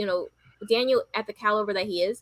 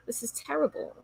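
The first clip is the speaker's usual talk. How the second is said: whispered; 5 semitones higher, 7 dB quieter than usual. Retold or imitated. Whispered aside in retold reaction.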